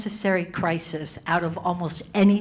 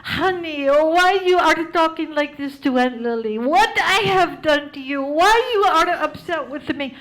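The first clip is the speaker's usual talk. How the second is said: Aunt Lily from Damascus; 8 semitones higher, 4 dB louder than usual. Retold or imitated. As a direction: wail it like a wounded old aunt. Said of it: "And she'd be like".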